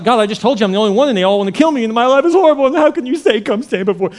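exasperated complaint. Acted. Elijah pleading his case to God, exasperated. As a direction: complain in a rising, put-upon rush.

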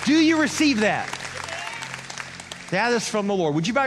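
high, loud, slow declaration; full-force preaching. Real, not acted. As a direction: build to a ringing proclamation over the congregation.

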